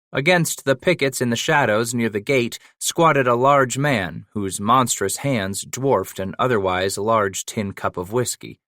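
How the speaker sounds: plain reading voice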